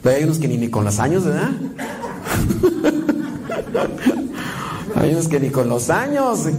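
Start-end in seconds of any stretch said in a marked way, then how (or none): 1.6-4.9 airy high laugh, no voice; quiet unvoiced chuckle; breathy laugh. Real, not acted. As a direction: let a soft breathless laugh escape. Laughing at own joke.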